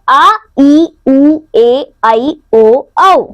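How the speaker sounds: आ, ई, उ, ए, ऐ, ओ, औ each held long; long vowels. Shown as a demonstration. Teacher lists the seven long vowels, holding आ, ई, उ, ए, ऐ, ओ, औ long. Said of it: "ह्रस्व स्वरों से दो गुना समय लगता".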